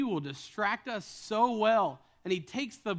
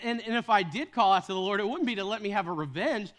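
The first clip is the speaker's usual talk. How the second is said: normal talking voice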